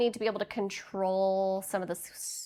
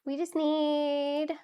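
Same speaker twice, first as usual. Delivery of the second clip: slow drawn-out; 4 semitones higher, much slower than usual. Pretend.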